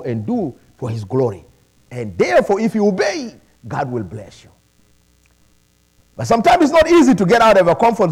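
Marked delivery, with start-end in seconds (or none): none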